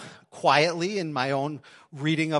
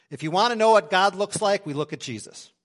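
ordinary talk

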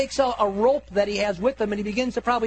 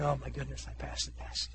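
fading into a whisper; very soft, low, whispered to himself; hushed relief; 6 semitones lower, 12 dB quieter than usual. Acted. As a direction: breathe it out under your breath.

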